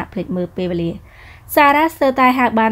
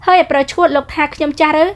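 higher, sharp retort from a proud heroine; haughty young woman; 7 semitones higher, 4 dB louder than usual. Acted. Heroine snapping back at the rude man, higher pitched. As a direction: toss it back at him, offended. Offended at being called old.

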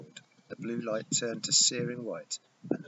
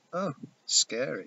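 amused; 5 semitones higher, 4 dB louder than usual. Acted. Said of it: "with amusement".

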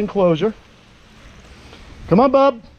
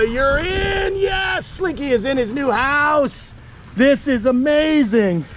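much higher, slow delighted cheer; excited triumph; 7 semitones higher, slower than usual. Real, not acted. Owner cheering as his lizard enters the new house, high and elated. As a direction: celebrate the big moment, drawn out and high.

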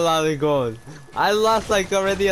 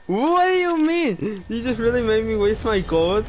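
outraged disbelief; 5 semitones higher than usual. Real, not acted. Told charge was unwanted.

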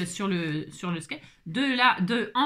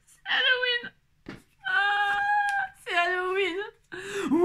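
high squeal through laughter; wailing, laugh-choked high voice; helpless hilarity; 12 semitones higher, much slower than usual. Real, not acted. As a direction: squeeze the words out while laughing. Laughing helplessly at her own mishap.

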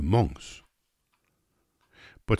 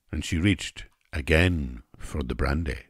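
ordinary delivery

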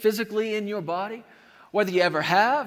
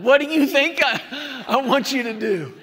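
incredulous laugh; 5 semitones higher, 5 dB louder than usual. Retold or imitated. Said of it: "I'm like".

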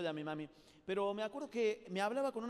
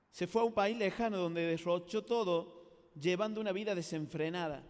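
scripture reading; 2 semitones lower, 4 dB louder than usual. Acted. Preacher reads the Bible verse.

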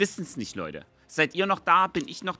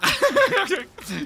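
breaking up laughing; high giggle fit; 14 semitones higher than usual. Real, not acted. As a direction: lose it laughing. Laughing at own list of ailments.

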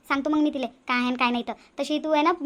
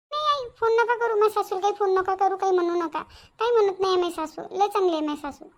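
anxious pleading; 4 semitones higher than usual. Acted. Pleading mother not to call.

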